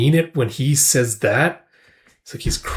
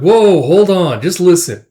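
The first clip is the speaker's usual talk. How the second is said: mock exclaim; 5 semitones higher, 5 dB louder, slower than usual. Retold friend stopping Guts.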